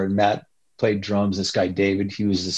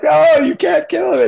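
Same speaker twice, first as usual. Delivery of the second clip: high panicked plea; mock panic, voice shooting up; imitating freaked-out friends on acid; 4 dB louder than usual. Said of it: "They're like".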